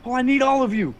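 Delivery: high, loud insistent penguin. Acted. Penguin Kowalski demanding the whole group, high and insistent. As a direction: lean on need like a demanding penguin.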